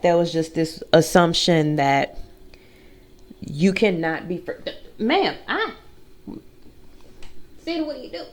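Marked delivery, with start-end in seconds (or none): 5.0-8.2 higher, soft playful scold; mock scolding dog. Real, not acted. Scolding the dog on her chest, high and hushed. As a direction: tease the dog for what it did.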